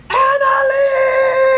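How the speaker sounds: huge high Tarzan shout; wild jungle yell. A narrator's reading. Boy calling his friend with a jungle yell. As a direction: yell her name across the playground. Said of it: "extra wild Tarzan jungle yell".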